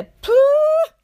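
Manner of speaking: a big high puff of giving up; loud exasperated puff. Retold or imitated. A test-taker fed up with failing, puffing out loud. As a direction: blow out the air, sharp and high. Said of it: "jag tänkte".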